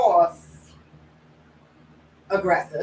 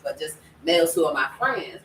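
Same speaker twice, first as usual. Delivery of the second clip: ordinary delivery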